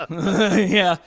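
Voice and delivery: normal talking voice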